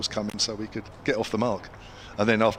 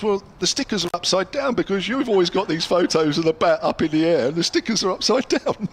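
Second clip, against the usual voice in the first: high, giggling retelling of the joke; can barely get the punchline out for laughing; amused teammate teasing, half laughing; laughing while telling; 9 semitones higher, 5 dB louder than usual. Retold or imitated.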